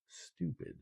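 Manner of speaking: whispered aside, barely any voice. Shown as a demonstration. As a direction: drop it to a hushed whisper. Reveals what the silent letters spell.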